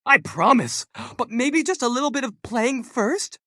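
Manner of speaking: put-on high eager child voice; bouncy boy begging for a treat; excited little boy. Boy's voice in story.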